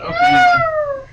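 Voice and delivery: sudden very high squeaky voice; high squeak. Real, not acted. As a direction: voice jumps up into a squeal. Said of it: "that was freaky".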